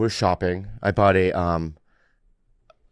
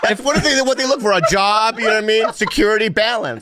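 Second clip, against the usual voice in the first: high mocking voice; 11 semitones higher, 3 dB louder than usual. Mocking what women look for.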